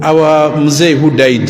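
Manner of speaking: normal talking voice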